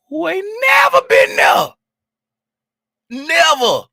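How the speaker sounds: high, loud stress on never; loud emphatic never; exasperated shout. Real, not acted. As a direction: yell it in frustration.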